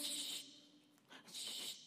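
long hissed "shh"; drawn-out mimicked hushing sound; imitated TikTok shush. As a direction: shush like the little TikTok girl. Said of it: "la chiquita de TikTok que te dice".

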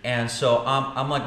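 usual speaking voice

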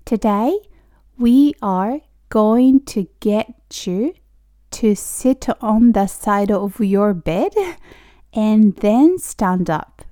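slow, word-by-word English model; slowly. Shown as a demonstration. Teacher leading learners through a long sentence, slowly. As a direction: take it slowly, with pauses between phrases. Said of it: "ゆっくりね".

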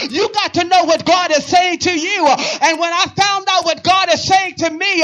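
high, loud full-force preacher delivery; impassioned preaching. Real, not acted. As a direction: drive it up to an incredulous cry to God.

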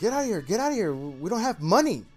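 high, loud mock brush-off with a laugh; hammed-up get out of here; shooing young mariachi. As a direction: shoo off the homeless-looking guy. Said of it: "So he's like".

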